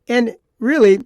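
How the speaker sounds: normal talking voice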